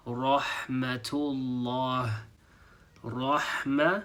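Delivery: rahmatullah slowly in an Arabic accent. Shown as a demonstration. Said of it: "mimic the accent".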